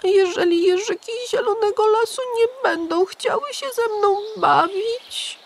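put-on high anxious child's question; a small boy fretting about new friends; worried little hedgehog. A narrator's reading. Igiełka worrying to the pigeon, high child voice. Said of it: "Nie dawał za wygraną malec".

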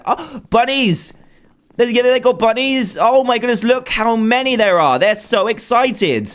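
high, delighted gushing over cute bunnies; excited. Real, not acted. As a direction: squeal with joy at the rabbits.

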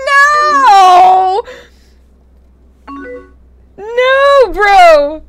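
high, loud shout of disbelief. Real, not acted. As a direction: yell no at the screen. Shocked by sudden game event.

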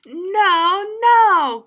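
high loud protest; loud, high pleading cry. Acted. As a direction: shout no like a kid refusing. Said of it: "cried Froggy".

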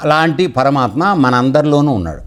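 ordinary talk, nothing marked

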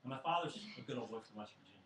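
ordinary talk, nothing marked

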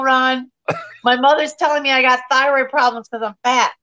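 ordinary delivery

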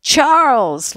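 loud, high yell from far off. A narrator's reading. Laurie running home, yelling to his mother. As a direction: yell the name up the hill. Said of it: "Laurie yelled all the way".

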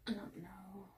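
soft unvoiced whisper. Real, not acted. Whispering to the camera over the unboxing. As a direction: breathe it under your breath.

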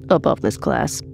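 plain reading voice

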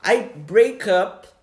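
slow, careful teacher example; slow English demo. Shown as a demonstration. Teacher to students, showing the phrasal verb slowly and clearly. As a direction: lay out each word slowly for the learner. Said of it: "Aí eu falo assim".

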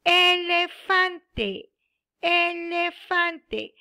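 elefante drawn out, the last vowel held long; teacher stretching a long word for pupils; slow model word. Shown as a demonstration. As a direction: say it very slowly, syllable by syllable. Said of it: "muy bien".

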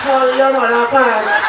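imitating a Trini woman's high, loud cussing; shrill island cuss-out, fired up and laughing; Trini lady cussing. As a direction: Trini woman hurling insults at a Jamaican. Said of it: "the Trinidad lady was like".

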